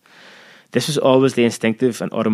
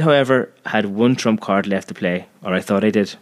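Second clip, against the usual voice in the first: ordinary delivery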